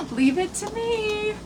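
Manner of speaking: gasp, then sigh it softly, laughing at yourself; breathy self-mock. Real, not acted. Mocking her own clumsiness to viewers, a soft sigh.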